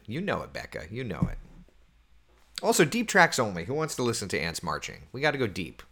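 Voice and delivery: ordinary talk, nothing marked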